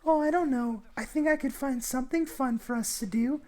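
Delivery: mocking the girl inviting him over, high and coy. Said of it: "And then she's like".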